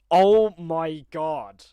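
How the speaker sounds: loud, very slow awe; overwhelmed astonishment. Real, not acted. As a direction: drag each word out in real amazement. Overwhelmed by the gift.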